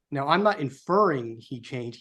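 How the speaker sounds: ordinary delivery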